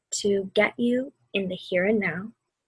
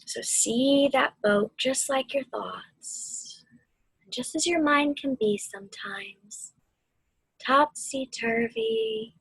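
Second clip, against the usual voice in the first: guided meditation. Acted. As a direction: ease them through the storm and back to calm.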